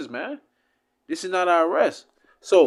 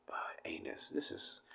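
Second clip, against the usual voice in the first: whispered word; 5 semitones lower, 21 dB quieter than usual. Real, not acted. Hushing a crude word.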